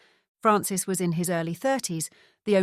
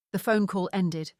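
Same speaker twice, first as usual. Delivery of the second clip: plain reading voice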